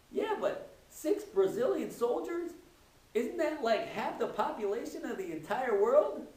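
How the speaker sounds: high-pitched, incredulous voice of a distressed woman; upset blonde. Acted. Crying woman to the man with the newspaper, high upset disbelief. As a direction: protest in a high voice, aghast at the number.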